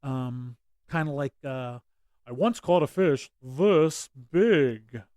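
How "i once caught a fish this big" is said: loud, slow bragging fish tale; boastful fisherman. Acted.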